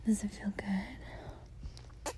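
very slow intimate whisper. Acted. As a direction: stretch each word out softly.

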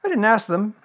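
high, loud offhand animal voice; careless. A narrator's reading. Rabbit explaining his friends and relations, careless. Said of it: "explained Rabbit carelessly".

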